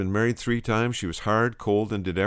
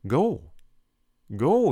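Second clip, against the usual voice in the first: high, louder urgent "Go"; emphatic command; 8 semitones higher, 4 dB louder than usual. Acted. Preacher voicing Jesus, urging disciples to go. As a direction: call it out like a command from heaven.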